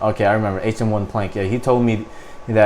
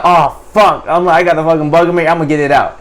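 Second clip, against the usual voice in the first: raised-pitch mock panic; retold panic; 5 semitones higher, 3 dB louder, faster than usual. Marine with a bug in his ear, mildly panicked. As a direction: replay the panic, lightly heightened. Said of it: "so I was just panicking".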